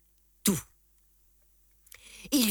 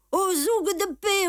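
put-on much higher, louder shouting old cobbler; old cobbler crying out; 10 semitones higher, 4 dB louder than usual. A narrator's reading. Old cobbler calling for the police from his shop, shrill cry. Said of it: "Un vieux cordonnier juif criait".

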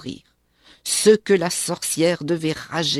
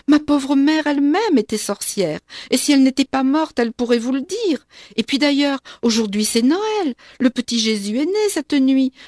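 higher, louder frantic begging; pleading; 6 semitones higher, 5 dB louder than usual. A narrator's reading. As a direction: babble excuses to save your skin. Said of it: "je me mets à supplier". (supplication affolée, plus aiguë et plus forte)

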